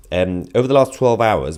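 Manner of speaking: ordinary talk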